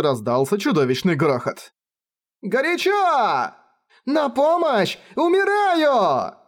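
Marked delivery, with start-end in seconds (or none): 2.4-6.3 much higher, slow anguished cries; desperate screams. Acted. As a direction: shriek while burning alive. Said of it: "Изнутри послышались истошные вопли". (истошные вопли)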